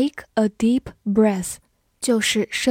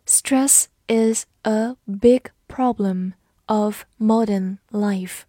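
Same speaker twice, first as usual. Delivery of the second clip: slow and even, each word given room; slow reading; slower than usual. Shown as a demonstration. Teacher slowly reads the example sentence for learners. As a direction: read it slowly for the class to repeat. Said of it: "好，慢读一遍".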